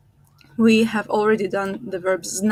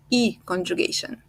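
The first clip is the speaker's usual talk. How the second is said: ordinary talk, nothing marked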